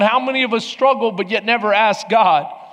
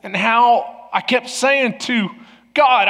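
ordinary talk

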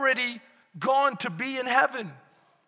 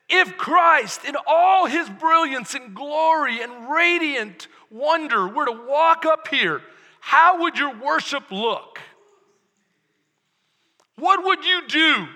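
quoted pastor; 5 semitones higher, 6 dB louder than usual. Retold or imitated. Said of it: "a pastor challenged us".

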